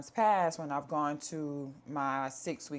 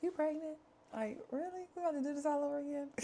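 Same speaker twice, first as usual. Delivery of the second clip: mock-shocked, much higher voice, the last line rushed fast; husband's disbelief; 11 semitones higher, 5 dB quieter, much faster than usual. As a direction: play the stunned husband, pitched way up. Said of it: "he was like".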